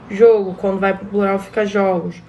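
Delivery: "Jogo" with a closed o, then "jogos" with an open o. Shown as a demonstration. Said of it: "tônico fechado e que se tornam tônicos abertos".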